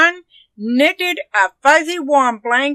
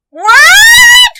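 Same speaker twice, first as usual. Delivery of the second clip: very high, loud shriek of surprise; granny's startled high yelp; shocked cry; 12 dB louder than usual. A narrator's reading. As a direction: shriek it in utter disbelief. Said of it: "cried Granny".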